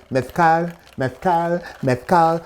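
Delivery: mezcal with the z said as a lisped th, mezkal becoming methcal; lisped Castilian mezcal. Shown as a demonstration. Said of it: "Do you hear what I keep saying?".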